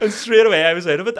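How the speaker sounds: high, loud, through laughter; real amused laughter in the voice; laughing while talking. Real, not acted. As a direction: push the words out mid-laugh. Still laughing at own story.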